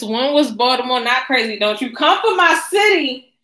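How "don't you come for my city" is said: higher and louder, playfully indignant; teasing scold to Damien; mock outrage. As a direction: warn him off my city, half joking. Playful defense of her city.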